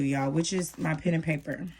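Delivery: normal talking voice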